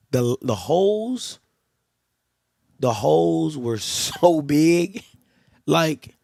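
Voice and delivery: slow, laughing through the words in disbelief; amused disbelief. Real, not acted. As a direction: marvel at how huge the gaps were, chuckling. Marveling at huge gaps in the line.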